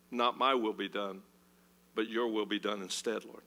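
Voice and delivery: whisper-soft giving up your will; hushed surrender prayer. Acted.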